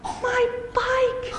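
high pitched dismayed cry; mock scream. A narrator's reading. Brooke finding her smashed bike, high shocked cry. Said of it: "she screamed".

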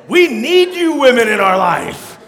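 ordinary talk, nothing marked

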